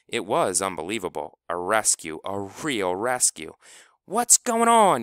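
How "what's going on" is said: loud high yell across the field. A narrator's reading. Klebo yells from home plate, loud and high. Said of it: "yelled Klebo from home plate".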